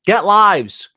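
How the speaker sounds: loud, exasperated yell; angry shout. Real, not acted. Ranting at the uploader who added music, loud angry shout.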